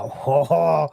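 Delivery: relishing the loot, mock-greedy; re-enacted glee. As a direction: crow over a lucky haul. Delight at finding loot in car.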